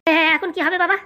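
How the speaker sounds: ordinary talk, nothing marked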